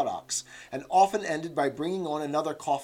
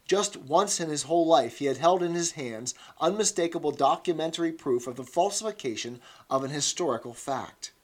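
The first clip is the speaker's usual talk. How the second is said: plain reading voice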